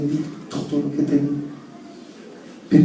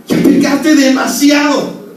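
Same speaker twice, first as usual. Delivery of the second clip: slow, loud, higher accusing shout; enemy shouting; 11 semitones higher, 9 dB louder, slower than usual. Acted. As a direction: proclaim the enemy's taunt at full force. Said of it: "El enemigo, ¿sabe qué te dice?". (grito acusador del enemigo, lento y alto)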